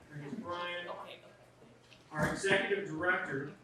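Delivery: ordinary talk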